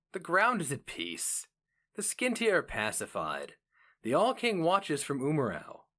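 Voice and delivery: a hint of a proud archmage, calm and lofty; lightly voiced archmage. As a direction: answer the rival like a lord at ease. Hero mage answers his rival.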